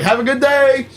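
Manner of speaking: high, singsong send-off; mock cheery farewell. Acting the guy at the locked door, mock cheery.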